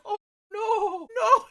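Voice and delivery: high-pitched imitated "oh no"; mock dismay. Storyteller mimicking his reaction to getting got, high mock dismay. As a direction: wail it high, mocking him.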